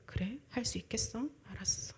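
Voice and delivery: hushed, soft answer; patient quiet indulgence. Retold or imitated. God answering the self-confident believer, softly patient. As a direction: humour a stubborn child gently. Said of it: "하나님이 좀 더 기다려 주시겠죠".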